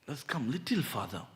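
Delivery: normal talking voice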